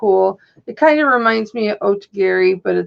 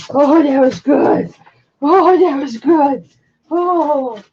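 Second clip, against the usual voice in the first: excited praise; 4 semitones higher, 5 dB louder, slower than usual. Real, not acted. Praising dog loudly.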